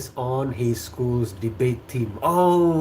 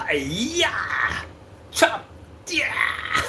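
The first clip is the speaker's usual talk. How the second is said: whispered mock battle cry, very high; mock butcher chopping cry; karate shout; 6 semitones higher, 4 dB quieter, much slower than usual. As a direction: hack away with a playful hiya.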